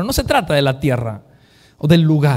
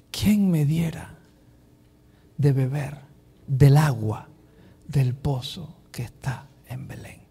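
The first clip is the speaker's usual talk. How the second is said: sighing longing; 3 semitones lower, 5 dB quieter, slower than usual. Acted. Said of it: "suspiró".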